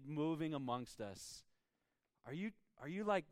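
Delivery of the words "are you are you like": ordinary talk, nothing marked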